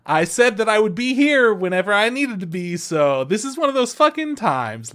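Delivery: high and loud, smug; cocky AI voice. Retold or imitated. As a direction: brush it off like a cheeky robot. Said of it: "And he's like".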